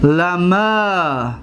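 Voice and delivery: اعلما drawn out slowly with a long final a; slow Arabic demo. Shown as a demonstration. Teacher demonstrating the dual form, slow and deliberate. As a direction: say اعلما slowly, holding the ending. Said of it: "همچنین".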